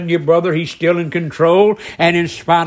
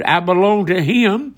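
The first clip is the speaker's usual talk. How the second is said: ordinary talk, nothing marked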